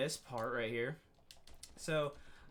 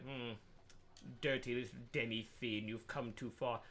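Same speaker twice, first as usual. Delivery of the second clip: low, soft sinister cat voice; menacing game cat; 5 semitones lower, 6 dB quieter than usual. Retold or imitated. Retelling the game's cat taunting the player, low and sinister. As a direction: purr it like a sly villain. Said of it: "the cat was like".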